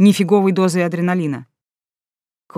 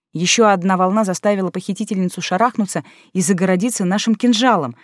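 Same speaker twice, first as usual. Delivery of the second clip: plain reading voice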